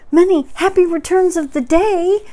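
high, loud, cheery hail; Pooh calling out. A narrator's reading. Pooh hails Eeyore across the river, calling out. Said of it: "called out Pooh".

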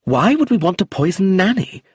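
high, loud indignant snap; Clemency defending herself, angrily; angry. A narrator's reading. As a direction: throw the accusation back. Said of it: "said Clemency angrily".

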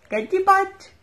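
high, loud playful coo. Real, not acted. Aunt teasing the baby, a high loud coo.

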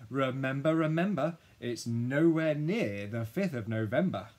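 loud, theatrical mock recitation; emphatic recital. A narrator's reading. Dad turning down sparklers with a rhyme, loud declaiming. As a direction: declaim it, stretching the last part.

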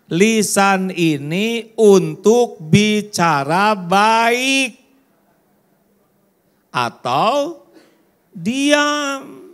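very slow and high, stressing "baik" and "diam"; slow emphatic maxim. Real, not acted. Preacher drives home the maxim to the congregation, slow and high. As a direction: spell out each word for the crowd.

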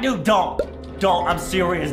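usual speaking voice

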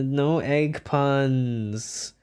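very slow "no egg puns"; long, dragged-out words; drawn-out refusal. Real, not acted. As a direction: stretch out each word of the refusal. Refuses, then repeats it normally.

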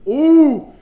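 loud high excited ooh; a big thrilled ooh at the screen. Real, not acted. Player reacts to taunt.

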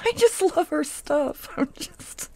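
gushing giggles as she talks; giggling. Real, not acted. Crafting vlogger giggling while praising her supplies.

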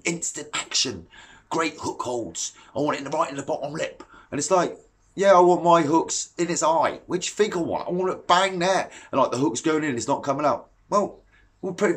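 normal talking voice

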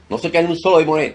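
high, quick rebuke of the demons; fast forceful command. Acted. Pastor ordering every demon out, quick loud command. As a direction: fire it off fast and hard.

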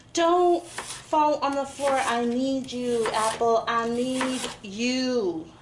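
slow, drawn-out melodramatic plea; mock pleading. Cook to dropped apple slices, hammed-up pleading. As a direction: beg with hammed-up desperation.

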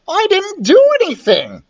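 high-pitched indignant protest. A narrator's reading. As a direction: protest your innocence like a schoolboy. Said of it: "I protested".

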